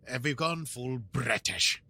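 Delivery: slow hammed-up British accent; mock British, retried. Host retrying the British accent, maybe Scottish. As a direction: try the British line again, slowly. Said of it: "Is that still British if I do that?".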